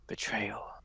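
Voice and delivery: one word spat out quietly with venom; venomous. Acted. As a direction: let the poison drip off it. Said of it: "kinda like with venom in her mouth".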